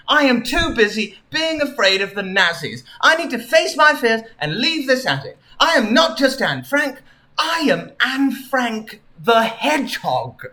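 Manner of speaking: a hint of triumphant self-discovery; resolute Anne Frank. Anne Frank declares who she really is, mildly dramatic resolve.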